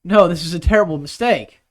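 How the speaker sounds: loud, alarmed protest. Real, not acted. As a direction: shout to stop him. Protesting drinking more beer.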